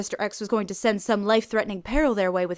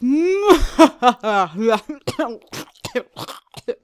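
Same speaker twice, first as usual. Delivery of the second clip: loud villainous cackle; evil laugh; 3 semitones higher, 7 dB louder than usual. Acted. Captor finishing his announcement, loud evil cackle. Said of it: "He ended with an evil laugh".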